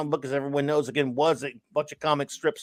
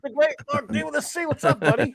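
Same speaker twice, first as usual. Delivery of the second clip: excited greeting; 11 semitones higher than usual. Real, not acted. Greeting arriving friend loudly.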